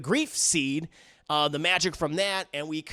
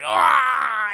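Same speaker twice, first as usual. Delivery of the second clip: loud firing yell; a short loud attack cry; battle yell; 6 semitones higher, 8 dB louder than usual. Retold or imitated. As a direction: yell as she pulls the trigger. Mommy fires the gun.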